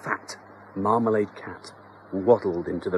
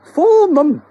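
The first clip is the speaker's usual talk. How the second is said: very high, loud put-on wife voice; panicked wife; 12 dB louder than usual. Wife voice held.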